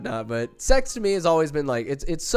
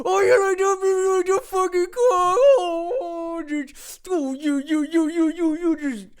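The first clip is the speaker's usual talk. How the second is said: over-the-top moaning. Retold or imitated. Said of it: "over the top".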